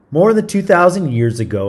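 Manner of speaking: ordinary delivery, nothing marked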